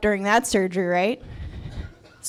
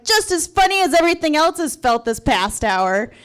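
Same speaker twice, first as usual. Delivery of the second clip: higher, louder sarcastic retort; 6 semitones higher, 5 dB louder than usual. Retold or imitated. Patient to doctor during surgery, sarcastic retort. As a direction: throw it back with pointed sarcasm. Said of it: "giving a sarcastic remark".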